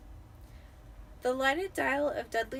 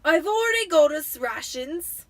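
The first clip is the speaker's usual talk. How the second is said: loud, higher proud uncle; Uncle Vernon; 6 semitones higher, 12 dB louder than usual. A narrator's reading. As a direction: boast about the rations. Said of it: "said Uncle Vernon".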